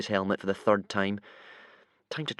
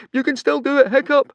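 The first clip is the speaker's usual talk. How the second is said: very high, loud encouraging shout; 9 dB louder than usual. A narrator's reading. Friend shouting encouragement to Hiccup, high and loud. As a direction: shout encouragement across the deck. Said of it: "he shouted".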